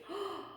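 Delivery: gasp. Pretend. What do you see.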